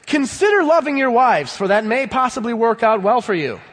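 mock tentative. Preacher mocking a wishy-washy Bible, mock-polite. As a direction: hedge it like a timid request. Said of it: "It doesn't say".

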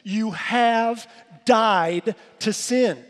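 "have died" slow and loud, leaning on the past tense; slow, loud, emphatic past-tense declaration; stress on past tense. Shown as a demonstration. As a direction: drag out "You have died to sin," slow and loud, landing hard on "sin". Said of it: "It is in the past tense".

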